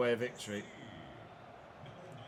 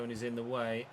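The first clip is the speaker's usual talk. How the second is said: ordinary delivery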